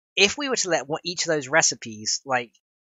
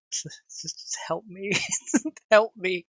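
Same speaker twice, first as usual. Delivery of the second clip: laughing; 6 semitones higher, slower than usual. Real, not acted. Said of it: "I can't compose myself now".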